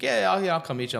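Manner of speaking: quick, high, eager yes from dad. Retold or imitated. Dad eagerly agreeing to lunch with his bored son.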